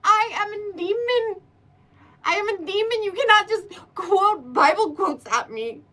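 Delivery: hammed-up demon complaining to a ghost; mock offended demon. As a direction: play a demon outraged by Bible quotes. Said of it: "And I'm like".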